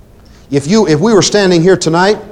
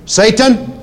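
Satan called out higher, bold; 6 semitones higher than usual. Shown as a demonstration. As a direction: call out Satan boldly. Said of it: "Say it out loud, please, boldly".

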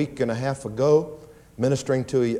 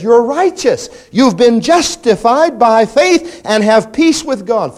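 proclaiming; 8 semitones higher, 8 dB louder, slower than usual. Retold or imitated. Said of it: "He says".

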